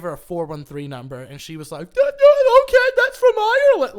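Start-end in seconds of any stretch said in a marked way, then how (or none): 1.9-3.9 very high, very loud mock squeal; hammed-up girlish shriek. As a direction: imitate a girl shrieking with excitement. Girl's excited reaction, retold.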